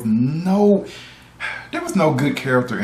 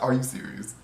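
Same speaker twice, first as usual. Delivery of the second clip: ordinary talk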